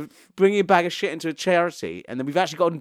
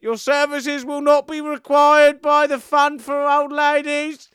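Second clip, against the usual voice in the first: slow, high, loud mock announcement over a speaker; hammed-up stern shop announcement; 11 semitones higher, 5 dB louder, slower than usual. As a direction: boom it out like a prim shop tannoy catching a customer. Voicing the shop's CCTV speaker.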